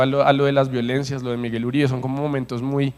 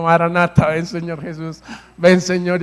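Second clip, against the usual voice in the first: louder, higher fervent cry of Maranata; shouting like mad; 3 semitones higher, 5 dB louder than usual. Retold or imitated. Preacher reenacting his prayer cry, loud and fervent. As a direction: cry out Maranata with full fervor. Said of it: "empecé a gritar como loco".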